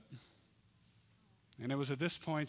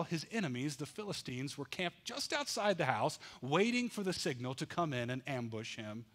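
usual speaking voice

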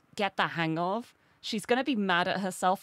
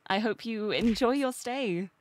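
ordinary talk

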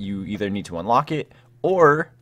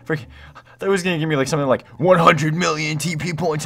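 higher and louder, building up; excited; 8 semitones higher, 5 dB louder, faster than usual. Real, not acted. To the chat about the gift, excited and higher.

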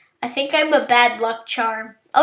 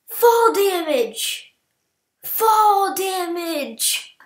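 drawn-out dismay; 7 semitones higher, much slower than usual. Real, not acted. Dismayed at falling.

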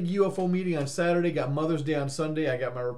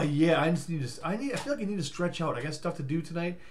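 ordinary talk, nothing marked

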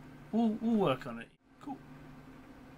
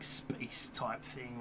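whispering; 2 semitones lower, 6 dB quieter, much slower than usual. Real, not acted. Drops to a whisper.

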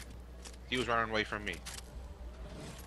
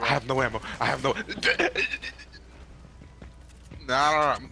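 panicking gamer; 3 semitones higher than usual. Real, not acted. Out of ammo, enemy coming.

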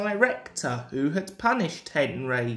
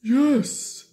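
eager; 7 semitones higher, 4 dB louder than usual. A narrator's reading. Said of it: "said Henry at once".

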